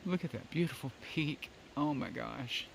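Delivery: soft, hushed and moved; hushed awe. Real, not acted. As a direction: speak softly, overwhelmed by beauty. Overwhelmed at the mountain view.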